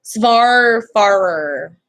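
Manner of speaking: "Svarfarer" stretched out slowly with an s-v start; careful pronunciation attempt. Shown as a demonstration. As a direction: draw out both halves of "Svarfarer". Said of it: "I apologize if I'm butchering that word".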